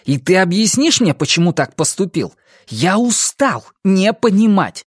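heated, exasperated challenge; angry demand. A narrator's reading. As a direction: demand an explanation in anger. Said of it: "злобно ответил юноша".

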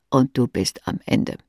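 ordinary delivery, nothing marked

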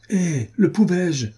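high, slow, pained cry of protest; cried out. A narrator's reading. Young man protesting to the marquis, high and slow. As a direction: stretch it out high, as if wronged. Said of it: "s'écria monsieur de la Guerche".

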